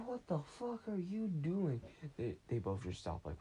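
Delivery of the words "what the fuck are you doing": shocked outburst. Retold or imitated. Gabriel catches friends eating.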